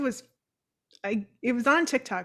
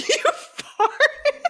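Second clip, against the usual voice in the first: incredulous; 6 dB louder than usual. Retold or imitated. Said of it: "I was like".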